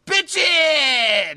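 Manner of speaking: high, very loud exclamation; drawn-out loud holler; excited yell. Acted. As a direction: shout it out, pitch way up. Acted exclamation in line take.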